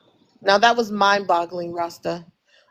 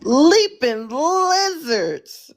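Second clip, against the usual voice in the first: drawn-out, high and far slower exclamation; astonished exclamation; 7 semitones higher, much slower than usual. Real, not acted. Host reacts to guest's mind-boggling rant, astonished exclamation. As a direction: stretch it out slowly in high-pitched amazement.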